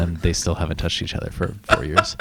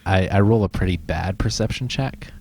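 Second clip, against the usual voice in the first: ordinary delivery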